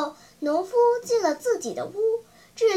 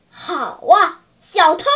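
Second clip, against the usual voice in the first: angry; 8 dB louder than usual. Acted. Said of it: "他生气地说".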